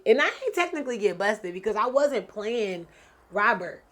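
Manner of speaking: normal talking voice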